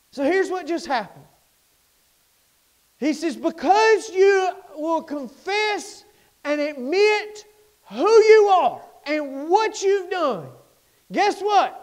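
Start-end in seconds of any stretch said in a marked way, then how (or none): 3.4-11.8 soaring preacher cadence, slow and sung out; whooping preacher build; preaching at full force. Acted. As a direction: lift it to the rafters. Preaching climax, sung line.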